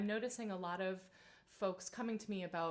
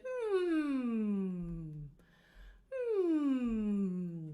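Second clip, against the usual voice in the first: humming; 6 dB louder than usual. Shown as a demonstration. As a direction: hum through closed lips. Humming warm-up after yawning.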